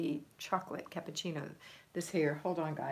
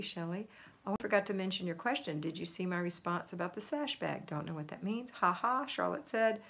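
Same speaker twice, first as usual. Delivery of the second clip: ordinary talk, nothing marked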